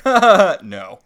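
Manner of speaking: loud fake laugh, then a flat no; hammed-up laugh and a dry no; mocking laugh then no. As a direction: cackle at her, then shut it down. Robin's mocking refusal.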